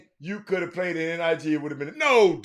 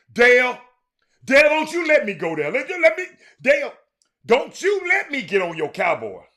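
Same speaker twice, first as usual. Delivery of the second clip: loud high pleading at Dale; loud begging shout at Dale; 8 semitones higher, 8 dB louder than usual. Real, not acted. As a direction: beg Dale not to push you there, loud and high. Mock pleading with Dale on stream.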